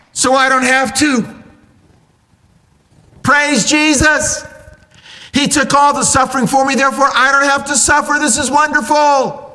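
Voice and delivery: high-pitched, exaggerated jubilation; over-the-top, high praise; mock rejoicing. Acting the cat rejoicing over no suffering.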